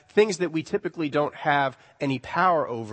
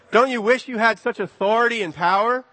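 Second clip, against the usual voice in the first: ordinary talk, nothing marked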